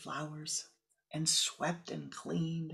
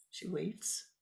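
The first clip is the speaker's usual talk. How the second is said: ordinary delivery, nothing marked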